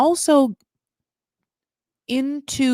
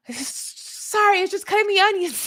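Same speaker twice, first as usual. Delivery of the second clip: high teary excuse; 10 semitones higher than usual. Pretend. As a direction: pretend the tears are just onions, voice up high. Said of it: "I almost wanted her to be like".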